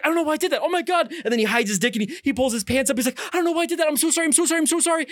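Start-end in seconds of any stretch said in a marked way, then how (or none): 0.0-1.1 high-pitched flustered pleading; acted-out distressed whine; panicked apology. Acts out David's panic. 3.3-5.0 high, rapid-fire frantic pleading; acted-out distressed babble; panicked apology. Acts out David apologizing over and over.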